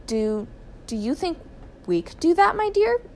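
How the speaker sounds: halting start, high questioning finish; hesitant question. A narrator's reading. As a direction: feel your way into the question. Wife hesitantly asks husband.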